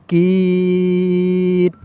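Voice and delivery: কিন stretched very long and slow; elongated কিন; long held vowel. Shown as a demonstration. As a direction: hold কিন as long as you can. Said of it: "আওয়াজটাকে লম্বা করে পড়তে হবে".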